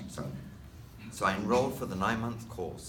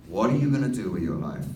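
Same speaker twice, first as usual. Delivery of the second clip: a touch louder, a hint of a pressing mum; mum's nagging question; 2 semitones lower, 7 dB louder than usual. Mum pressing her son about his future, mildly raised. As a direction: lean in like a parent demanding answers.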